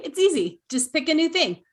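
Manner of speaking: high, loud playful tease; mock breezy. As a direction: toss it off like it's nothing. Teasing echo of old pattern.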